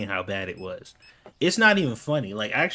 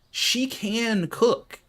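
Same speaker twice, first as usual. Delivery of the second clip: every word stretched slowly, high; slow, high insistence that "She can cook"; emphatic, drawn out; 10 semitones higher, much slower than usual. Real, not acted. Emphatic point about wife.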